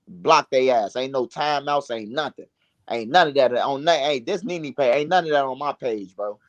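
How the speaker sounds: ordinary talk, nothing marked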